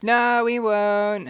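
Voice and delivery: put-on high, slow sing-song kid; childish mock reply. Kid answering mum's warning, high drawn-out mock innocence. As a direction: drag it out high and loud. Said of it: "and I'm like".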